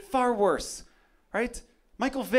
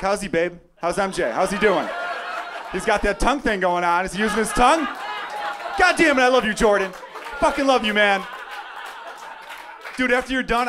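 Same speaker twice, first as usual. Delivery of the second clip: starstruck fan; 2 semitones higher, 4 dB louder than usual. Pretend. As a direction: cheer him on like your idol. Cheering Jordan in bedroom scene.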